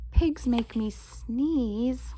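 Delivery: ordinary delivery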